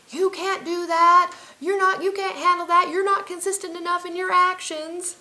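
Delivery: high, loud, slow and taunting doubter; nagging inner voice. Retold or imitated. The doubting inner voice mocking her goals, high and loud. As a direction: sneer it down at yourself like a nagging critic. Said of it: "head used to be saying".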